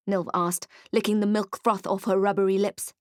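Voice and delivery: ordinary delivery, nothing marked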